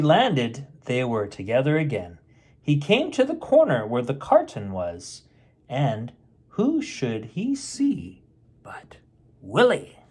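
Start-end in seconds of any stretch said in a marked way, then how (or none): 5.7-10.0 slow whispered build-up before the reveal; suspense whisper. A narrator's reading. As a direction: whisper it slowly, drawing out the suspense. Suspense in story.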